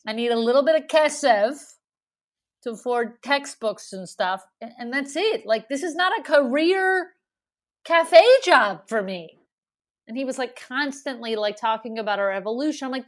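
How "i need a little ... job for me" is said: emphatic pushback, building louder and higher; exasperated retort. Retold or imitated. Student telling a bossy café manager it's temporary, emphatic exasperation. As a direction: set the boss straight, stretching the last words.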